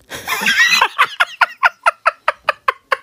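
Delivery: long high staccato laugh; high wheezing cackle; real laughter. Real, not acted. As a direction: lose it at your own joke. Cracks up at own joke.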